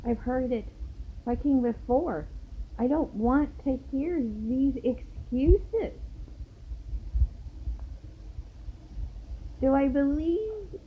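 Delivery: high-pitched, fed-up protest; incredulous and indignant, pitch well up; retold exasperated refusal. Acted refusal of their excuses.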